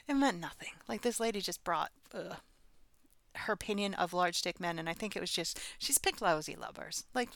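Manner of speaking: ordinary talk, nothing marked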